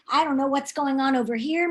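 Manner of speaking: plain reading voice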